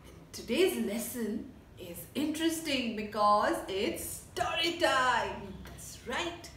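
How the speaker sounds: slow, friendly teacher opening story time; storytelling teacher. Acted. Teacher opening story time for her class, friendly. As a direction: welcome the class like a children's storyteller.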